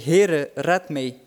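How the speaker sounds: drawn-out desperate plea; cry for help. Acted. Peter sinking, crying to Jesus for rescue. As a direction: call out slowly as he sinks. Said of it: "riep hij".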